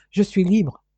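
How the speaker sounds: daughter's sincere declaration of freedom; earnest announcement. Acted. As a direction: declare LIBRE as good news. Daughter announces her freedom to father. (annonce sincère, un peu vive)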